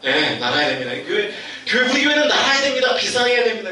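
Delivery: soar into the proclamation; imitating a preacher getting carried away; excited preacher.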